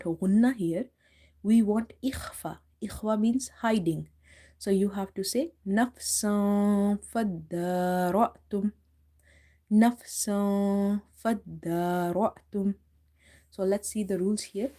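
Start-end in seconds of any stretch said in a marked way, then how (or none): 5.7-12.7 nafsan faddara'tum very slow, n hidden; slow model recitation. Shown as a demonstration. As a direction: recite it slowly, stretching nafsan. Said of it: "hiding this sound and little bit elongating".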